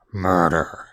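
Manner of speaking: dropped low and dark. Acted. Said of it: "Sorry, my voice got low".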